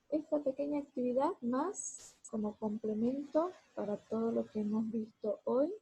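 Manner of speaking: normal talking voice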